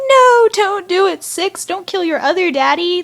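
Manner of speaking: pleading yell. Retold or imitated. Viewer yelling at Six on screen.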